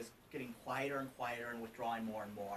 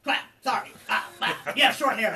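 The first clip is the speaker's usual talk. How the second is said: much louder, higher flustered apology with a laugh; flustered stranger; 7 semitones higher, 14 dB louder than usual. Retold or imitated. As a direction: imitate the startled stranger fumbling an excuse. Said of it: "And they go".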